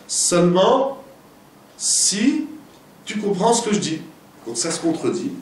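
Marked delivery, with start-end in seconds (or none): 1.8-4.0 si leaps high, then the rest stays raised; si said high and held before the phrase; stress on si. Real, not acted. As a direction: lift si sharply as the condition. Teacher stressing a key condition.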